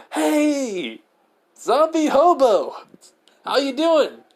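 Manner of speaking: high, loud teasing hail; calling out. Acted. Izuku hails the zombie hobo, calling out high and loud. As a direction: holler a cheeky greeting, stretched out.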